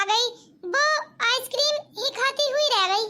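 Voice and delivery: put-on a small girl telling on her sister; a hint of an innocent little girl explaining; child voice. Child Tina explains to aunt.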